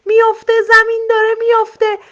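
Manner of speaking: very high, loud alarm cry; panicked shouting. A narrator's reading. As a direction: yell it while running in panic.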